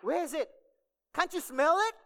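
high, loud comic comeback; hammed-up incredulous question; mock rude retort. Acts the wrong reply to visitor.

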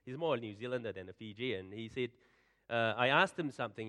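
ordinary delivery

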